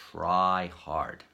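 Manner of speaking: emphatic "Try hard". Retold or imitated. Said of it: "If we try really hard".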